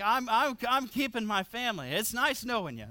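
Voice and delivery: normal talking voice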